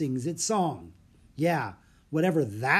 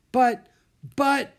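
stammering; 11 semitones higher, 7 dB louder than usual. A narrator's reading. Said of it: "stammered Jack".

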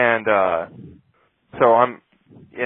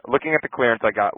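normal talking voice

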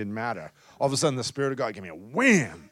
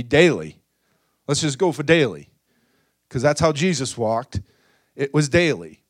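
loud, driving preacher's call to the crowd; loud revival preaching; full-force preaching; 3 semitones higher, 7 dB louder than usual. Acted. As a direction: preach it out hard to the room.

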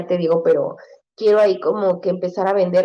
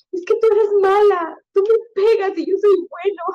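put-on high-pitched little-boy protest; imitated hurt son; 13 semitones higher than usual. Son after a smack accusing his mother, high hurt child voice. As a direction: plead like a wronged child, pitched way up. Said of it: "me dice".

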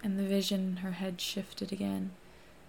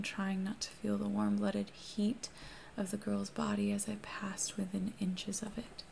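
usual speaking voice

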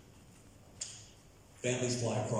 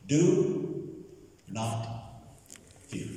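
long pauses between DO, NOT and FEAR; word-by-word emphasis; 3 semitones higher, much slower than usual. Acted. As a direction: DO pitched high, then NOT and FEAR each alone. Key phrase quoted word by word.

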